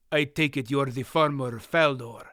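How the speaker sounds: put-on Murgo's line in a light foreign accent; hint of a heavy foreign accent, read gently. A narrator's reading. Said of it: "in his heavily accented voice".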